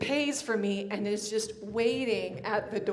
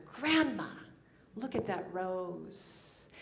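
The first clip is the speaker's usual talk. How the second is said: softer and slow; tender aside; 3 semitones lower, 4 dB quieter than usual. Acted. Minister speaking to her late grandmother, soft and tender. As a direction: point it out softly, sharing a secret.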